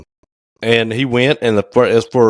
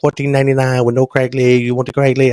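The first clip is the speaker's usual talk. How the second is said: higher-pitched, rapid-fire mock Chinese accent; imitated Chinese accent; 5 semitones higher than usual. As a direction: rattle it off in a put-on Chinese accent. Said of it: "that's how he talked".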